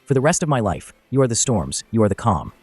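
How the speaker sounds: ordinary talk, nothing marked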